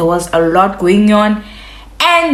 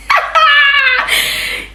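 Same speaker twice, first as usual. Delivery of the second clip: sing-song laugh. Real, not acted. After the mock prayer.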